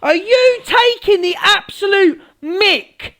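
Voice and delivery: high, slow, indignant demand; outraged challenge. Real, not acted. Streamer confronting Angie, outraged challenge. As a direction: draw out the outrage word by word.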